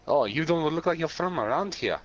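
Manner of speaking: imitated German accent in a retold line; heavy German accent. Bar patron greets the newcomer, heavy German accent. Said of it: "with a heavy German accent".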